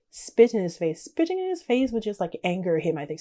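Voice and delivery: ordinary delivery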